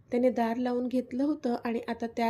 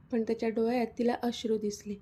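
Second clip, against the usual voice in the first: ordinary delivery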